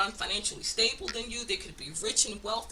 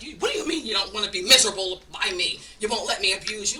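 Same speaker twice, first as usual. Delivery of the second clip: mocking them; 8 dB louder than usual. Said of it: "and they sit there like".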